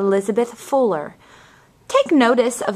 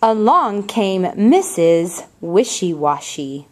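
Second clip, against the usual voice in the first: slow, expressive storybook reading; picture book read aloud with lively character voices; reading to children; slower than usual. Acted. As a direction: read it like story time for little ones.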